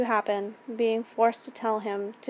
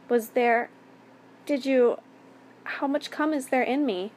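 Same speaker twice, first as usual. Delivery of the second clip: halting question, pitched a little up; tearful; 3 semitones higher than usual. A narrator's reading. Victim asking the attacker afterwards, mildly tearful. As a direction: falter between restarts, a touch tearful. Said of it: "I asked through my tears".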